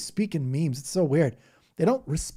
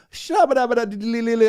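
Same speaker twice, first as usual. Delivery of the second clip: much higher, louder rapid babble in a changed voice; mock speaking in tongues; 9 semitones higher, 9 dB louder than usual. Imitating the second lady dueling in tongues, higher and louder. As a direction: go over the top on the nonsense. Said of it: "saying this weird stuff".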